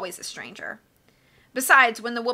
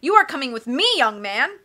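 louder, stern command; ordering; 3 semitones higher, 6 dB louder than usual. A narrator's reading. Banker to a boy who says goodbye, ordering. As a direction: lay down the law to a child. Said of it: "Mister Poe ordered".

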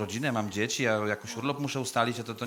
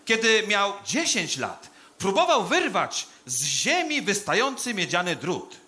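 slow, high, loud declamation; hammed-up graveside proclamation; pompous funeral oration; 8 semitones higher, 5 dB louder, slower than usual. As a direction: draw out each phrase like a grandiose eulogist. Delivers a funeral speech.

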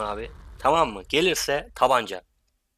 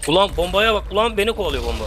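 excited yell; 7 semitones higher, 6 dB louder than usual. Real, not acted. Chased by a bomb in game.

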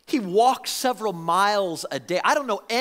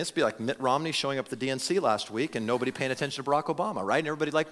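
usual speaking voice

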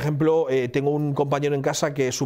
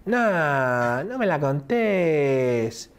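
slow, sing-song Argentine drawl, pitched up; exaggerated Argentine accent; much slower than usual. Imitating Papo's Argentine to the group, exaggerated sing-song. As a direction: lay the Argentine singsong on thick and slow. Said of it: "Papo hace mucho el rollo".

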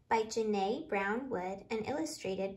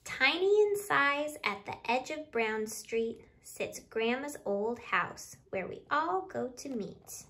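louder, lively picture-book reading in rhyme; reading to children; 6 dB louder than usual. Acted. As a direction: read the counting rhyme out to the little ones with bounce. Reads picture book aloud.

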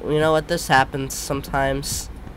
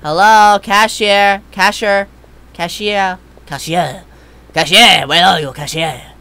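calling out; 3 semitones higher, 7 dB louder, slower than usual. Real, not acted. Calling out for the cashier.

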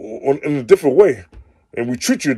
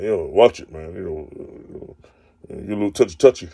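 mock jealous boyfriend; 6 semitones lower, 4 dB quieter than usual. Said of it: "don't try to threaten me, like".

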